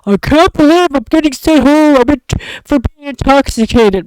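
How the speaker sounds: mock him as a self-pitying brat; mocking whiny coworker. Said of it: "he's texting me saying".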